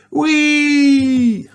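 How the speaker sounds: high squeal; gleeful whee. Acted. Mummy scooting, squealing.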